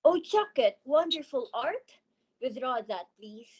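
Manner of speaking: scornful. Acted. Leading man rejects mock praise.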